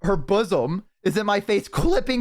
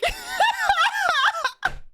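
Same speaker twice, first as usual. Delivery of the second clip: very high real cackle; helpless laughing shriek. Real, not acted. Streamer cracks up telling chat about the dance, shrieking laugh. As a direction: burst out in squealing laughter.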